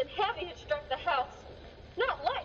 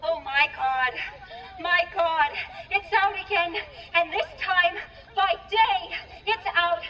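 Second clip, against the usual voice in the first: louder frantic cries of alarm; panicked shouting; 6 dB louder than usual. Acted. Panicked villagers after monster sighting.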